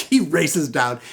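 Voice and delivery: usual speaking voice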